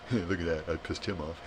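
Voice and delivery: quiet chuckling gloat; gloating snicker. Acted. Troll who just upset someone, quietly gloating. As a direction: snicker softly to yourself.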